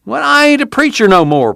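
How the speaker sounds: high, earnest objection; plaintive country preacher's refusal; protesting. A narrator's reading. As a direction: protest with the pitch jumping up. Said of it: "Casey protested".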